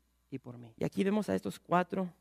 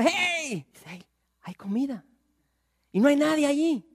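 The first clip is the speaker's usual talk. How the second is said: high excited call; eager shouted news; calling out to sentinel; 10 semitones higher, 8 dB louder than usual. Acted. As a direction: shout up to the guard.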